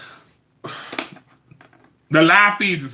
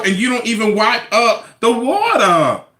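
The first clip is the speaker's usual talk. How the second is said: ordinary delivery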